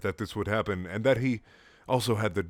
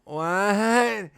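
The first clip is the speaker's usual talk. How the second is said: higher, frail confused voice; weak confused Amy; 10 semitones higher, 4 dB louder than usual. Acted. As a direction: ask it dazed and tired. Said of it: "looking confused and tired".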